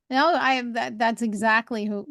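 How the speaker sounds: ordinary talk, nothing marked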